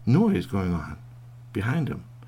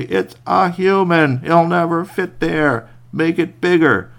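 slow, loud call from above; 7 semitones higher, 8 dB louder, slower than usual. Acted. Wolves calling down the hole, slow and loud. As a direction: boom it down the hole.

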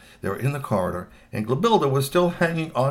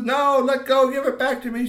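high, raised-voice protest; yelling; 12 semitones higher, 5 dB louder than usual. A narrator's reading. Girl dragged out of the room, yelling. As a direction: yell it high as you are dragged off. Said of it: "she was yelling".

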